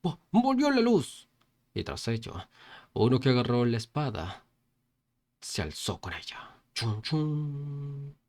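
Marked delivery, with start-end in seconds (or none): 0.0-1.3 high-pitched startled shout, a little drawn out; stammered, high exclamation; crowd's cry. A narrator's reading. 6.7-8.2 drawn-out vocal sword effect; sustained mouth-made sound effect; imitated sound effect. A narrator's reading.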